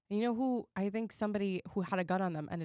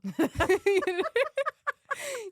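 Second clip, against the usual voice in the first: burst of laughter; 13 semitones higher, 6 dB louder than usual. Real, not acted. Laughing at own joke.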